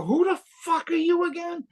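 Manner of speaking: high, stunned by his playing; incredulous.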